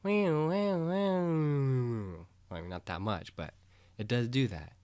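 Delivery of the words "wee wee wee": high siren wee wee; siren imitation. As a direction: wail like an ambulance. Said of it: "it goes".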